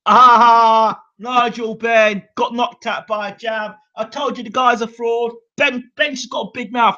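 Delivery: high, mocking gloat; mocking dad. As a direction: rub it in like a gloating father. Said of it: "my dad started laughing at me".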